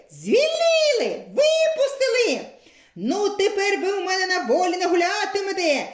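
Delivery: значно вище й голосніше, люто; лютує, що в'язнів випустили; лютий Крижан. A narrator's reading. (лютий Крижан кричить значно вище й голосніше)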